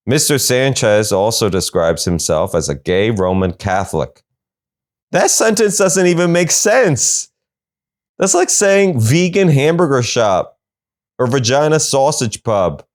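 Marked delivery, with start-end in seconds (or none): 5.1-12.8 higher, disbelieving rant; can't believe this makes sense; incredulous. Real, not acted. Incredulous comic outburst.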